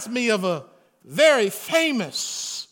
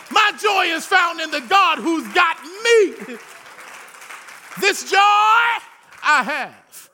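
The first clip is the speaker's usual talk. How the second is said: loud, high preaching shout; preaching at full force; 4 semitones higher, 3 dB louder, slower than usual. Real, not acted. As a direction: lift it up and shout the joy. Preacher's climax.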